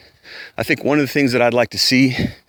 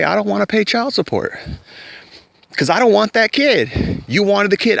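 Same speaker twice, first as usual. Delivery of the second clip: high-pitched acted refusal; protesting; 9 semitones higher than usual. Man refusing to pay child support, protesting. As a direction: push back in a higher, insistent voice.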